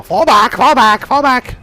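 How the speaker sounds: high, loud goblin shouts; goblins yelling retreat. Acted. Game master voices goblins retreating from battle, yelling. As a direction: yell the order high and urgent. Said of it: "you just hear the goblins yell".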